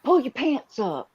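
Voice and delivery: calling out. Real, not acted. Telling her son Colin to pull his pants up, loud call.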